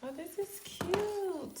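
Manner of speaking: high, drawn-out coo of delight. Real, not acted. Unboxer finding a cute case, delighted cooing. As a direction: coo slowly over something adorable.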